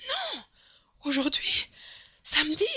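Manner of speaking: high, loud cries, word by word; frantic correction. A narrator's reading. Servant correcting Mr. Fogg on the day, frantic. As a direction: cry out each word with urgency.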